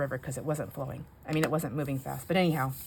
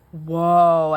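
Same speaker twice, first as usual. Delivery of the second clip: startled whoa; 11 dB louder than usual. Retold or imitated. Retold panic looking down water.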